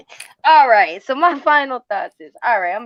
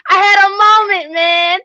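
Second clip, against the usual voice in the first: hammed-up outburst; 9 semitones higher, 4 dB louder than usual. Acting out a country-accent outburst, laughing.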